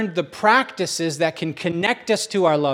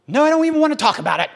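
whiny wife; 10 semitones higher, 4 dB louder, much faster than usual. Retold or imitated. Wife voiced in the story.

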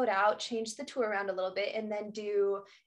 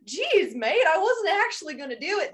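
a clumsy mock Australian accent, louder; botched Aussie impression of a tourist; bad Australian accent; 5 semitones higher, 6 dB louder than usual. Mock Australian accent from a tourist caught touching a turtle. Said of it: "That was my worst accent".

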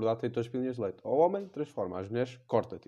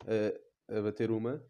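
normal talking voice

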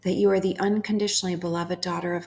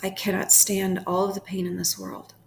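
unhurried prayer with long pauses between phrases; guided prayer meditation. Acted. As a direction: pray it phrase by phrase, leaving room to repeat.

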